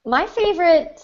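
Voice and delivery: ordinary delivery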